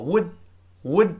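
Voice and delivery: "would" said short, final d unreleased; quick spoken form of "would"; quick reduced would. Shown as a demonstration. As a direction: clip "would" with a held, silent d. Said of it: "would读快之后".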